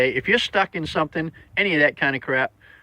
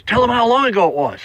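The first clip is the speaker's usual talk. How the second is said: higher, louder mock old lady; other old lady; 9 semitones higher, 6 dB louder than usual. Second elderly lady prompting her friend, higher louder mock voice. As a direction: chime in like an eager elderly woman.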